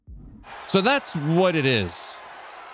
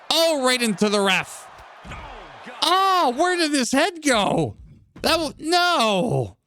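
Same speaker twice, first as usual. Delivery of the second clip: high-pitched gamer disbelief; astonished, voice shooting way up; shocked outcry; 11 semitones higher, slower than usual. Real, not acted. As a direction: yelp in amazement as it goes wrong. Shocked reaction to game mishap.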